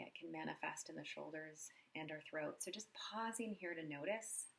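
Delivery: soft, unhurried meditation voice with long pauses; guided meditation. Acted. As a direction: guide them gently through a body scan, quietly. Leads a body scan meditation.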